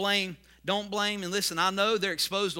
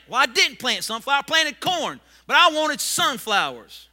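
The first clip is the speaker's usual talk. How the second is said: sulky farmer; 6 semitones higher, 9 dB louder than usual. Acted. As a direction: insist you wanted sunflowers. Farmer still upset.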